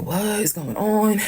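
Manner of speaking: high, slow puzzled gawking; bewildered neighbors. Retold or imitated. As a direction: play the nosy neighbor baffled. Neighbors staring at the arrest.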